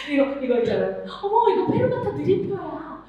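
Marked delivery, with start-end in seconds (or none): 1.2-3.0 playful teacher voice. Shown as a demonstration. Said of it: "이런 거 있잖아요".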